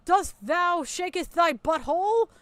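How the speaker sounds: slow, hammed-up mock Shakespearean recital. Streamer mocking Lyle's fancy words with hammed-up Shakespeare. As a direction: ham it up like a bad Shakespeare actor. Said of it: "he's about to tell us Shakespeare here".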